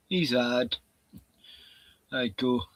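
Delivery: usual speaking voice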